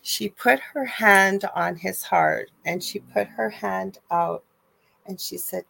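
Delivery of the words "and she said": normal talking voice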